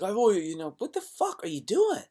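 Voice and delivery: very high, shrill-pitched scold; berating yourself for a mistake, very high; self-scolding. Retold or imitated. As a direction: squeak it out at yourself. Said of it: "You're like".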